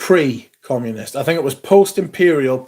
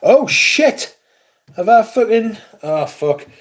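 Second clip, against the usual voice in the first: real alarm; 7 semitones higher than usual. Real, not acted. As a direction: blurt it as the error dawns. Realises mistake on stream.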